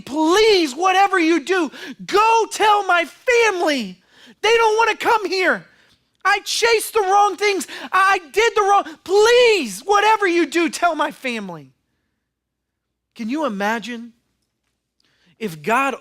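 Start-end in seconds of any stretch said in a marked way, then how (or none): 0.0-11.7 high, louder, anguished begging; desperate cry from hell. Acted. Rich man crying up from hell to be heard. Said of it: "he's saying".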